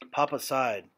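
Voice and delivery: ordinary delivery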